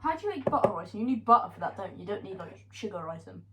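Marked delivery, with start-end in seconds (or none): none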